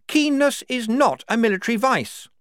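high, loud objection; indignant major. Acted. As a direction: protest firmly up high. Major protests to general.

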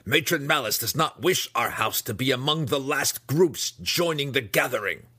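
high-pitched, insistent scolding. A narrator's reading. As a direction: chide them for dawdling. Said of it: "she scalded".

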